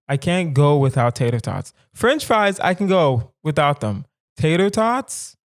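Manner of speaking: ordinary delivery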